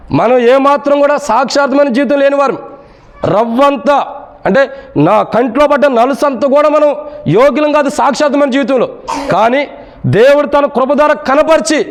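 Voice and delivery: high, sustained sermon delivery; impassioned preaching. Real, not acted. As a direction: preach it in a high, driving chant-like voice. Preaches at heightened pitch. (ఉద్వేగంతో ఎత్తైన స్వరంలో ప్రసంగం)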